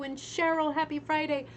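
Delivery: normal talking voice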